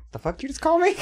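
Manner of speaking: high, hammed-up offended snap; mock tough retort. Big Lee imagined snapping back, mock offended. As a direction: play an offended tough guy, lightly.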